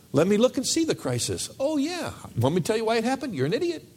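high mocking voice of God; mock God, high and snide; sarcastic God.

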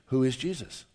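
usual speaking voice

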